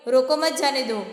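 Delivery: "रोको मत जाने दो" run together without a pause. Shown as a demonstration. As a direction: read "रोको मत जाने दो" straight through in one go. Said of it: "first मैं रीडिंग करती हूँ".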